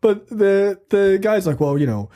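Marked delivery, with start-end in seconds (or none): none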